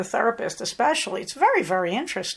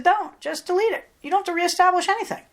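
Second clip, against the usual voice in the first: exasperated; 8 semitones higher, faster than usual. Retold or imitated. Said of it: "I said".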